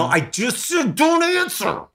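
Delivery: high-pitched exasperated outburst; exasperated protest. Real, not acted. Telling brother not to answer, high exasperated protest. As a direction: cut in, voice leaping way up.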